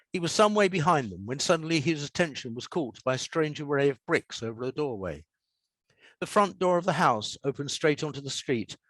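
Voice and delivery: measured storytelling read; story narration. A narrator's reading. Author reading his story to an audience, calm narration. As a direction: read the extract to listeners, unhurried.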